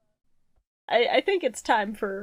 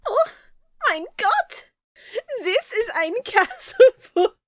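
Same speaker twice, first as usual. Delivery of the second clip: higher, slow mock German accent; 10 semitones higher than usual. Meme recreation of a German boy, hammed-up German accent. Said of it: "I do that accent".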